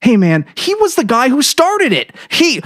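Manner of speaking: high loud protest. A narrator's reading. Narrator protesting to the cook, urgent. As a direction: argue back at the cook.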